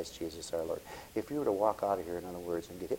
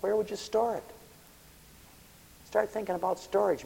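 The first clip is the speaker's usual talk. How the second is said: pitched way up, playful jab; high mock question; 13 semitones higher, 7 dB louder than usual. To the greedy guy, a high teasing question. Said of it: "I says".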